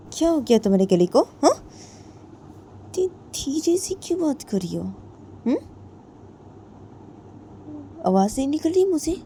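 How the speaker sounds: high, loud taunting demands; mocking bully. A narrator's reading. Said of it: "जिया बोलती है". (ऊँची, तेज़ आवाज़ में ताना मारती)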